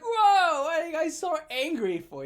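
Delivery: loud high fake-surprised whoa; a big high whoa, then teasing; mock surprise. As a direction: act shocked to tease them. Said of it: "I was like".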